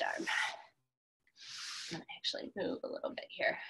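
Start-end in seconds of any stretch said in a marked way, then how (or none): 1.9-3.6 whisper. Real, not acted. Whispered aside mid-workout.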